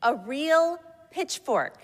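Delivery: put-on high, slow, emphatic small child; toddler voice. A narrator's reading. Child insisting to Santa, slow high toddler voice. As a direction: insist on it like a little kid. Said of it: "my child continued".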